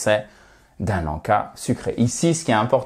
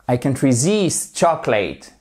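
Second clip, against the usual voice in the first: resist accentué sur -SIST; accent sur la seconde syllabe. Shown as a demonstration. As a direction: appuie sur la fin de resist. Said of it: "votre accentuation sur la seconde syllabe". (resist, seconde syllabe bien marquée)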